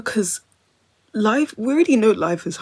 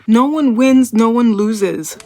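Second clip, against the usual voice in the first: louder, slowing on the second half; loud proclamation; 3 semitones higher, 7 dB louder, slower than usual. Real, not acted. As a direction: declare it like a motto. Proclaiming a motto.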